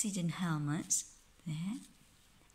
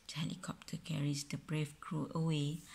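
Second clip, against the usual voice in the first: plain reading voice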